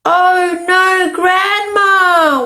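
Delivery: long high storybook wail; hammed-up cry of dismay.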